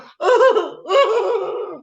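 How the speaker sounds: high, loud hammed-up sob; mock sobbing wail. Caller imitating the crying shop assistant, loud mock wail. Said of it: "like that".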